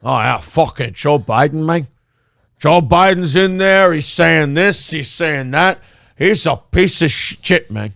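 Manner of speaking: lightly voiced buddy impression; a hint of a guy griping about Biden; Royce impression. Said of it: "That's a pretty good Royce impression".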